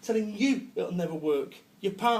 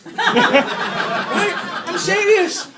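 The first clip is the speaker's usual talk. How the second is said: laughing through the words; cracking up while insisting; real laughter breaking through; 9 semitones higher, 10 dB louder, much slower than usual. Real, not acted. Laughing at own joke.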